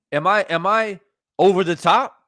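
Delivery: ordinary talk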